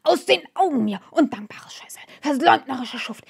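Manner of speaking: high, loud snorting rage; old man's outraged screaming fit; furious shout. A narrator's reading. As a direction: scream him out of the room. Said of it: "schnaubte und schrie endlich".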